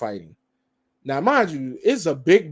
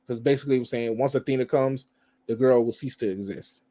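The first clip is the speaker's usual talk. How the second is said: ordinary delivery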